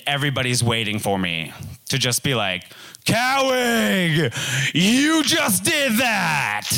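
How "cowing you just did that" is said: mocking crowd. Friends mocking him on return.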